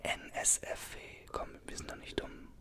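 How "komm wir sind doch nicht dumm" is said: ordinary talk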